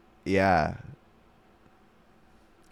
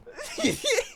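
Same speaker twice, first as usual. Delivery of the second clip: high squealing laugh. Real, not acted. Laughing fit.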